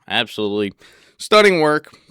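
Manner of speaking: bursts out loud on stunning work; gushing loudly. Real, not acted. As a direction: rave at full volume. Admiring the bedroom.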